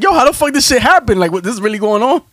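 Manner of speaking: high, incredulous thinking aloud; stunned disbelief. Retold or imitated. Mid-fight, asking himself how this happened, stunned. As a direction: sound caught off guard. Inner thought during fight.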